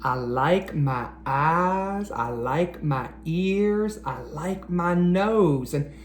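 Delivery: lively rhyming picture-book read-aloud; reading to children. Acted. Teacher reading a rhyming book to boys and girls, storytime read-aloud. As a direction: read each rhyme out to a room of young kids.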